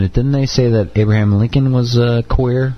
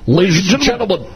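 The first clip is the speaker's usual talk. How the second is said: higher blustery radio-host voice; Rush impression; 8 semitones higher than usual. Podcast host imitating Rush Limbaugh's catchphrase. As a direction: boom it like a talk radio host. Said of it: "What does Rush say?".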